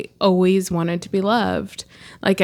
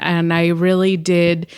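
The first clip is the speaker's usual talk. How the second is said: usual speaking voice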